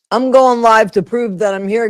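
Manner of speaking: ordinary delivery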